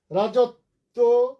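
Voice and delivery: রাজত্ব called out loud and slowly as rajotto. Shown as a demonstration. Teacher reads a student's word aloud, loud and slow. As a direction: stretch রাজত্ব, stress the double t. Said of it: "রাজত্ব আমরা এখন আসি নাই".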